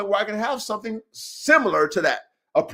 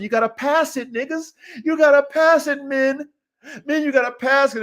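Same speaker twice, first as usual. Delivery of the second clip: preaching; 6 semitones higher than usual. Real, not acted. Preaching at his audience.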